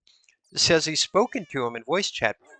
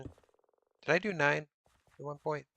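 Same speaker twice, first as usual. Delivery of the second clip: ordinary talk, nothing marked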